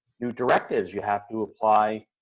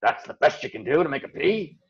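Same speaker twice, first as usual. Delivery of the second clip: critical inner voice; 2 semitones higher than usual. Retold or imitated. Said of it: "I hear this voice go".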